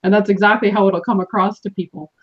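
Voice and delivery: normal talking voice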